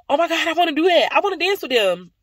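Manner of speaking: high, fast, thrilled burst; excited gasp. Retold or imitated. Vlogger recalling seeing the dance group, excited. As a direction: gush like you just found it.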